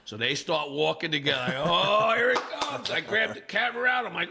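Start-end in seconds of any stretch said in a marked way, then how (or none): 1.7-2.9 gleeful anticipation. Retold or imitated. Eager for a fight to film.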